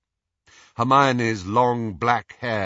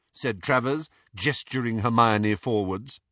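plain reading voice

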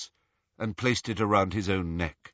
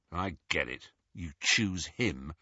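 bitter quiet; 6 semitones lower, 7 dB quieter than usual. Acted. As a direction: say it coldly, wounded.

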